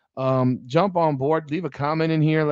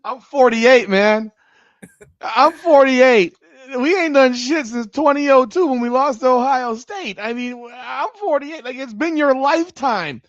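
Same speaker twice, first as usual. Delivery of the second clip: exasperated outburst; 8 semitones higher, 5 dB louder, slower than usual. Real, not acted. Exasperated fan venting about age.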